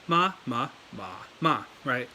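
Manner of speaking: "ma" repeated, sinking low; third-tone "ma", settled at the bottom. Shown as a demonstration. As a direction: drop each "ma" down low without a big rise. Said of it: "sounds down there low".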